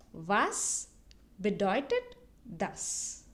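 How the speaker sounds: slow, separated "Was", "bedeutet", "das"; slow model phrase. Shown as a demonstration. Teacher repeats the question for learners, slowly word by word. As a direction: leave a pause after each word of "Was bedeutet das". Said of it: "repeat it twice so that you can listen".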